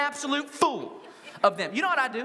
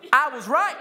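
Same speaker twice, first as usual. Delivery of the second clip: loud, higher-pitched mock boast; 4 semitones higher, 7 dB louder than usual. Men telling everybody, boasting. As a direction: announce it loudly, crowing.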